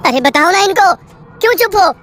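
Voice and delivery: urgent pressing. Acted. Narrator presses silent man.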